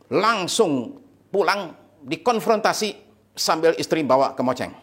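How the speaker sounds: usual speaking voice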